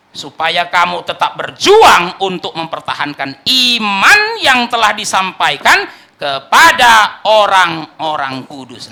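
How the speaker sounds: loud, slow, weighty verse reading; solemn scripture reading. Real, not acted. Preacher reading Jude's verse to the congregation, loud and slow. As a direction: proclaim the verse slowly to the church. Reads the key verse aloud.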